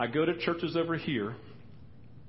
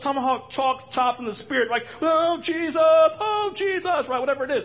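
much higher and louder, hammed-up indignant reply; mock cerebral believer; 14 semitones higher, 9 dB louder than usual. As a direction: squeal it back like an offended churchgoer. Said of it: "And they're like".

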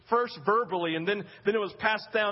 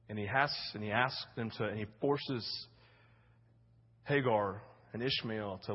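hushed, grave; 10 semitones lower, 5 dB quieter than usual. Real, not acted. Preacher realising a father sends his child away, hushed gravity. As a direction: let the sorrow sink in, barely voiced.

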